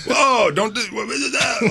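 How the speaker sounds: his own video game voice mocked as silly; over-the-top game voice. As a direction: ham it up like an animated character. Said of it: "sounds nothing like me on the show".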